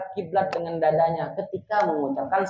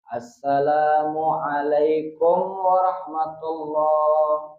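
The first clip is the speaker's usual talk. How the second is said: Assalamualaikum warahmatullah drawn out slowly and smoothly; slow perfect salam demo; much slower than usual. Shown as a demonstration. Teacher models the perfect prayer salam slowly.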